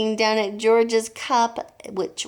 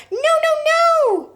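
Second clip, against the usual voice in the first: shrill cries; 8 dB louder, much slower than usual. A narrator's reading. Family shouts warning.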